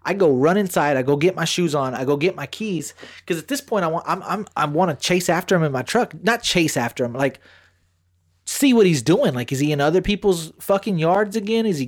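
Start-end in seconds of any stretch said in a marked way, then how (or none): none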